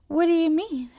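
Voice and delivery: a loud baffled question; baffled what. Retold or imitated. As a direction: throw it back in disbelief. Confused reaction to doctor's news.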